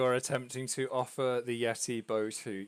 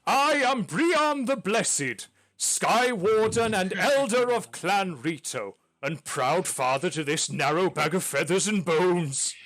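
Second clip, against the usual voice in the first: powerful voice; 6 semitones higher, 8 dB louder than usual. Acted. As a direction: address the party like a grand clan elder. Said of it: "given the power in his voice".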